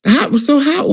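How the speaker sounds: ordinary talk, nothing marked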